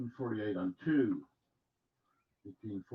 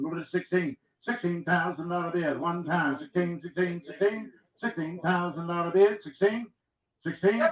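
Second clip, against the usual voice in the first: auctioneer; 6 semitones higher, 5 dB louder than usual. Acted. Auction bid calling.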